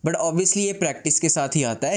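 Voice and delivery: ordinary delivery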